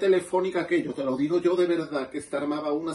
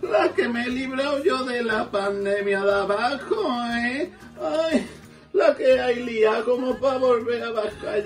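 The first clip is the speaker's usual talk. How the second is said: put-on high, slow, laughing old duchess voice; comic duchess voice from heaven, much higher; Duquesa de Alba; 8 semitones higher, slower than usual.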